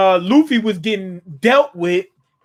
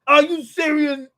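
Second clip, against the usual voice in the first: shocked disbelief; 8 semitones higher than usual. Retold or imitated. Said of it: "Kaido's looking like".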